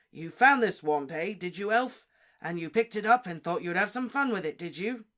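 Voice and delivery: loud, slowing, accusing; Diggory accusing Winky; menacing interrogation. Acted. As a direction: press the elf hard.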